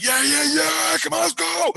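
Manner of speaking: high, pumped-up mock holler; retold battle cry. Dreamer daring zombies round the corner, high excited holler. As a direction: psych yourself up to charge. Hyping himself to fight zombies.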